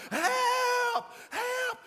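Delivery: high, loud, hammed-up wailing yells; ugly-cry holler. Man clinging to a tree, imitated ugly-cry holler. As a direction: wail for help like a cartoon victim. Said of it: "that ugly cry kinda coming on".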